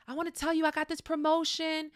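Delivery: acted happy announcement; excited sharing. Telling friends about a new job promotion, excited.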